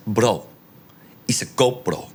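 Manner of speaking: lightly imitated Spanish dude; casual mock Spanish voice; Spanish accent. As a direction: give it a touch of Spanish accent. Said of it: "he's a Spanish dude".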